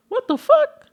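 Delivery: very high, loud shocked cry; startled shriek. Acted. Mock reaction seeing man on ceiling, shrieking.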